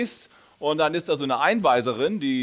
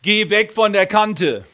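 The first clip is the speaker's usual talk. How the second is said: slow, loud, higher call from the mother; loud warning voice; 5 semitones higher, 8 dB louder, slower than usual. Retold or imitated. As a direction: warn her loudly and slowly away from the edge. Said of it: "die sagte ziemlich laut". (laute, langsame Warnung der Mutter)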